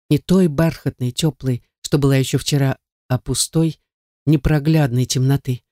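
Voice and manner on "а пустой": usual speaking voice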